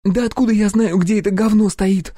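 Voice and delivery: high-pitched, exasperated female outburst; losing her temper. A narrator's reading. Pupil blowing up at her gun instructor, losing her temper. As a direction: flare up in a high, indignant voice. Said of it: "психанула она".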